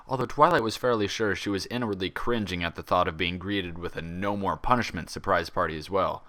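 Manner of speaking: usual speaking voice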